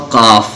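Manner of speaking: قاف with a deep back "q" and rounded vowel; heavy letter. Shown as a demonstration. Teacher listing heavy letters, thick held "qaaf". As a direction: hold قاف full and thick. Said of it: "جو موٹے پڑھے جاتے ہیں".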